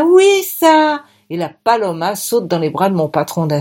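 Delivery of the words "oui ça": high, bright burst of joy; delighted squeal. A narrator's reading. Paloma's delighted reply.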